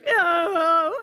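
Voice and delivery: very high, loud "No."; high, loud blunt no; flat refusal. Retold or imitated. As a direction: shut him down in one word. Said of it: "I was like".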